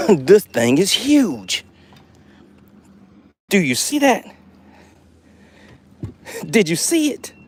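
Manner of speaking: eager, wide-eyed disbelief; thrilled angler showing off a big catch; excited. Real, not acted. Showing huge fish to viewers.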